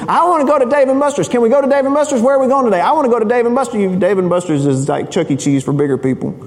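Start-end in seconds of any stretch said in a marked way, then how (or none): 0.1-3.8 put-on high, pestering child voice, rushed; whiny kid Luke. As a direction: beg like a kid who wants the arcade. Son begging to go to arcade.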